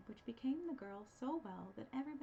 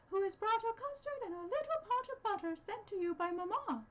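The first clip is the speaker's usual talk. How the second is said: wolf imitating girl; 12 semitones higher, 7 dB louder than usual. A narrator's reading. Wolf faking the girl's voice at the door. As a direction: fake a little girl's voice. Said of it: "imitating her voice".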